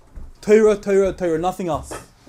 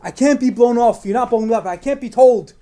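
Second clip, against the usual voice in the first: insistent plea; 4 semitones higher, 5 dB louder than usual. Retold or imitated. Husband insisting on a blessing.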